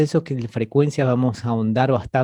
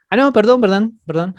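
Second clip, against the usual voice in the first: much higher and louder, caught out; sudden realization; 8 semitones higher, 5 dB louder than usual. Real, not acted. Teacher realizing she misread the student's answer, surprised. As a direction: blurt out the apology.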